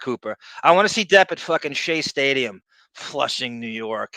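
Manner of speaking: normal talking voice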